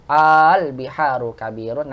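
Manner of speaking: "Al biharu kabirun" slowly with held opening aa; long questioning a. Shown as a demonstration. Teacher summing up two hamzahs meeting, lengthened "Al biharu kabirun". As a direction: stretch the first a of "Al biharu kabirun". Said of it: "panjangnya a".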